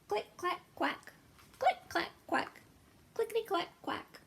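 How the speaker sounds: very high clicks and quacks; chirpy clickety clack quack; typewriter and quack sounds. Acted. Typing ducks sound refrain.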